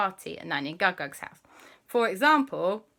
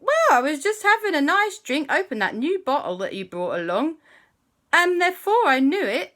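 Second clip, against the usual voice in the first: tiny Gug-Gug voice; 10 semitones higher, 5 dB louder than usual. Acted.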